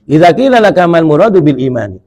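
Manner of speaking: loud, formal kitab recitation; reading the kitab aloud to the congregation, loud and formal; recited Arabic text. Shown as a demonstration. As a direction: declaim the Arabic line like reading scripture aloud. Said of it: "reading the Arabic text".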